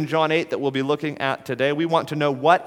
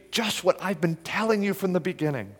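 softer, gentle invitation; inviting appeal; 4 semitones higher, 7 dB quieter than usual. Pretend. Jesus gently inviting the Pharisees, soft appeal. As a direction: coax them in kindly. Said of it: "a tone of appeal, invitation".

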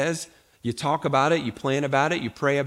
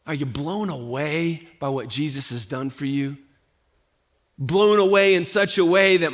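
ordinary delivery

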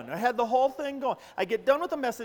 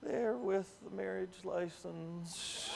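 low, slow, soft admission; sheepish mumble; 3 semitones lower, 8 dB quieter, much slower than usual. Retold or imitated. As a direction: say it sheepishly, slowly. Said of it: "he goes".

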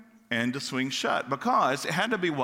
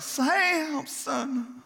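crying out; 9 semitones higher, slower than usual. Retold or imitated. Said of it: "Delilah again cried out".

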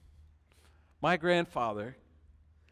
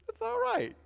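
high, light voice; gentle reply. Retold or imitated. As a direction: answer kindly in a much higher voice. Employees answering protesters kindly.

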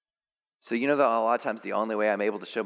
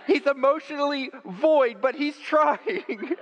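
very high, laughing through the words; high wife voice, slowing and laughing; 4 dB louder, slower than usual. Retold or imitated. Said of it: "your wife will think".

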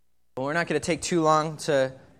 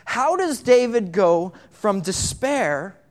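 ordinary talk

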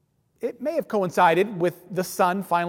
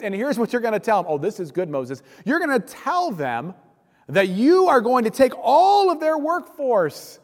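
mock-cheerful, swooping up high on the big promises; heavy sarcasm; 6 semitones higher, 4 dB louder than usual. Moses mimicking God's orders about Pharaoh, dripping sarcasm. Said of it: "an inordinate amount of sarcasm".

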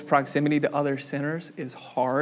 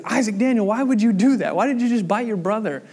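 higher, louder stern father; scolding dad; 6 semitones higher, 5 dB louder than usual. Retold or imitated. Dad scolding his son in the van, higher and louder. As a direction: call the kid out by his full name.

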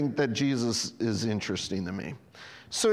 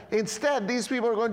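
ordinary talk

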